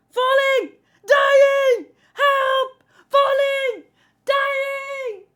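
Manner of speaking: panicked yelling. Acted. Reader voicing falling crew, frantic loud yells. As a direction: scream each word in panic. Reading panicked character lines.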